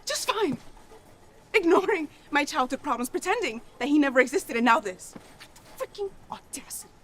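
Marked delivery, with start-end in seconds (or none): 1.5-5.1 loud, raised-pitch venting; angry yelling. Acted. Abandoned child rants at a nurse, angry. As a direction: pour out the grievance, high and loud. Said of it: "I'm sorry for yelling at you".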